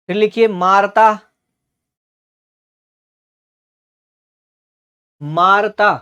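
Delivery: teacher calling out words to copy down; slow dictation. Real, not acted. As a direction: dictate each word clearly, then wait for writing. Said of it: "धीरे धीरे बोलूंगा".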